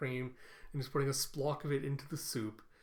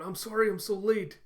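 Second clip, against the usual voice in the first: higher, louder acted apology; hurried apology; 6 semitones higher, 6 dB louder than usual. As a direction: blurt it out as the latecomer. Acted apology on arriving late.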